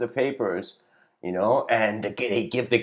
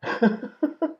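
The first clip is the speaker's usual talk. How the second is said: short high burst of laughter; 3 dB louder than usual. Real, not acted. Storyteller to viewers, laughing at the hell owner line. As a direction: crack up at your own punchline.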